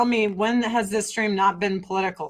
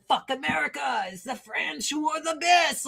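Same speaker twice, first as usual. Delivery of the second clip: higher, softer put-on French character; mock French person; 5 semitones higher, 6 dB quieter than usual. Streamer imitating a French person bragging, mock-proud French voice. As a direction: play a proud French patriot, pitched up and held back. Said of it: "French person be like".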